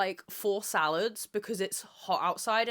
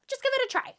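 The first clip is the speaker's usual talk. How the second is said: mimicking a teacher; faster than usual.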